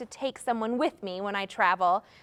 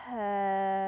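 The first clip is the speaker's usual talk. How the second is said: slow hello; 5 semitones lower, 4 dB quieter than usual. Shown as a demonstration. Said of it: "as slow as we can".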